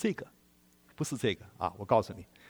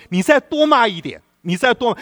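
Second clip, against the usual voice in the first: mock eager invitation; 9 semitones higher, 5 dB louder than usual. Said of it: "你告诉他说".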